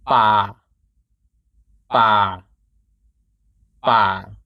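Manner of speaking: BA dropped low and held steady. Shown as a demonstration. Teacher drilling the low tone for learners, low steady tone. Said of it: "To make the low tone".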